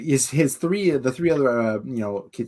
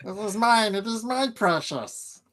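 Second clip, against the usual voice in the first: higher, slow hissing Gollum; 10 semitones higher, 3 dB quieter, slower than usual. Pretend. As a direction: whine it like Gollum. Gollum parody of quote.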